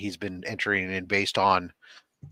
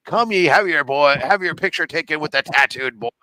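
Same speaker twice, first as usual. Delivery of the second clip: higher, louder carnival barker call; circus barker; 4 semitones higher, 4 dB louder than usual. Acted. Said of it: "you have a circus barker outside".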